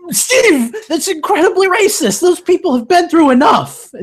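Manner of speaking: high, loud mock outrage. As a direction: play it up as shocked scolding. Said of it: "Just come out and be like".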